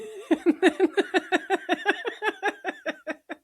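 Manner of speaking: high, rattling run of laughter; long laugh. Real, not acted. Laughing at own confession of morning dread, long high laugh.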